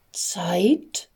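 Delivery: hushed, breathy whispered question; voiceless whisper. Acted. Hoarse angel asks Gabriel, whispered with no voice. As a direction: whisper it, the voice is gone. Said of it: "fragte Eleison".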